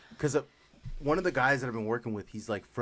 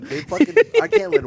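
much higher and louder, mock-heroic; mock defiant outburst; 13 dB louder than usual. Real, not acted. Vowing to friends not to let them win, loud mock defiance. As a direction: declare it like a battle cry, joking.

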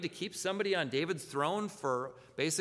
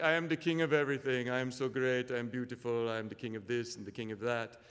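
lightly voiced vain cartoon king boasting; a hint of a pompous lemur king, showing off; King Julian voice; 3 semitones lower than usual. Pastor playing King Julian bragging to the congregation, light mock pomp. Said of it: "he's like".

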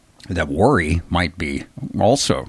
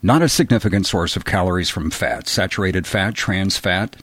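ordinary talk

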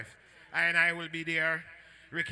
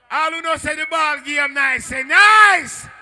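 loud, high MC shout; rally the crowd, surging on the last word; crowd call-out; 7 semitones higher, 15 dB louder, slower than usual. Real, not acted. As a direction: belt it out to the dance. MC calling out to the crowd.

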